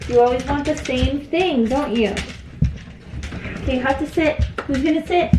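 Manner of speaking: sing-song, as if teasing eager dogs; talking to pets. Real, not acted. Talking to dogs before giving treat.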